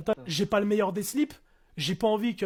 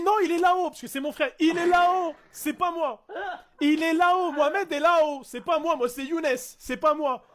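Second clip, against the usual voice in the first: panicked pleading; 5 semitones higher than usual. Retold or imitated. Pleading with cop, denying identity.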